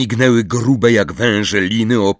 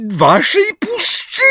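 slow, very high cry; indignant shriek; 3 dB louder, slower than usual. A narrator's reading. Umbridge disputes the centaurs' claim, shrieking. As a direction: shriek it, outraged and disbelieving. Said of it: "krzyknęła Umbridge, teraz trzęsąc się".

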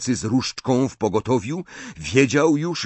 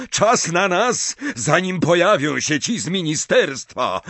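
shouted order; 6 semitones higher than usual. A narrator's reading. Snape urging his comrade to leave, calling out. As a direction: call the retreat. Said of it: "krzyknął do wielkiego śmierciożercy".

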